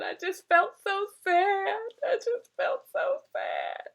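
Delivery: high, slow tearful sob of a quote; imitating a girl crying it out. Girl quoted in a workshop, slow high tearful crying. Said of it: "it's crying".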